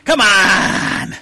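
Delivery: long drawn-out, exasperated vowels; exasperated pleading. Real, not acted. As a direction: drag it out, begging a stalling friend. Exasperated coaxing of stalled friend.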